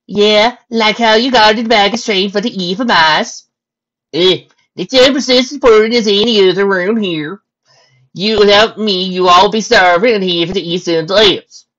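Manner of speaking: loud, high soldier voice; bantering stallion soldiers. Acted. Stallion soldiers ribbing each other about their duties, loud and high. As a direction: banter loudly with the pitch up.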